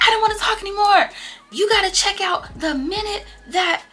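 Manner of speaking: high, loud whiny friend voice; mimicking Coco upset. Friend Coco quoted in quarrel.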